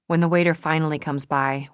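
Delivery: plain reading voice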